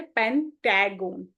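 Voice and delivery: "पेंटागन" broken up slowly, middle said as "टै" (pen-TAE-gon); deliberate spelling pronunciation. Shown as a demonstration. Teacher showing a spelling trick, "पेंटागन" said slowly as pen-tae-gon. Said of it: "आपने कैसे करना है".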